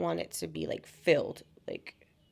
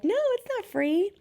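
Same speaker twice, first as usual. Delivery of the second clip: high, bright mock showgirl, much higher. Showgirl telling tourists photos aren't free, high mock voice. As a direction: chirp it like a sweet showgirl.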